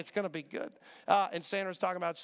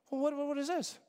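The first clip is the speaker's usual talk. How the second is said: surprised; 8 semitones higher than usual. Retold or imitated. Sees huge suitcases.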